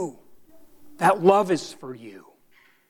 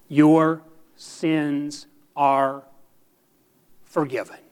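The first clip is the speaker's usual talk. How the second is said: very slow, word by word, dropping at the end; measured, deliberate assurance with weighty pauses; solemn pronouncement; 2 semitones lower, slower than usual. Real, not acted. Preacher declares forgiveness to the congregation, slow and solemn.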